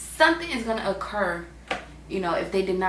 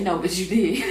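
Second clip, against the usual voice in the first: words squeezed out through laughter; amused at God's retort; laughing; 5 dB louder than usual. Real, not acted. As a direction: read it while cracking up. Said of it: "why that is so funny to me".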